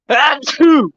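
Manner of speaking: sudden high sneeze; loud sneeze. Real, not acted. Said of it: "That was me sneezing".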